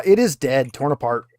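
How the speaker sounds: ordinary talk, nothing marked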